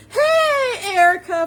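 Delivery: high, loud call of hello; excited greeting. Real, not acted. Host greeting a viewer joining live, excited greeting. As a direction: squeal hello as a friend pops up.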